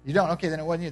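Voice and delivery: ordinary talk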